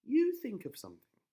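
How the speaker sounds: put-on high, loud girlish voice; a princess ordering him, pitched high and loud; high and loud, demanding; Rapunzel, bossy. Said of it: "said Rapunzel".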